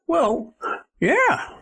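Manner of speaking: put-on high, drawn-out old-timer reply; unbothered old man, laughing a little. Old man tells the young man his dog is fine, high old voice.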